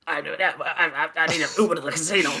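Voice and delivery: high, stammering mock voice; hammed-up frantic rambler; babbling crackhead. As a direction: gabble it fast, tripping over the words. Mimics the babbling man from story.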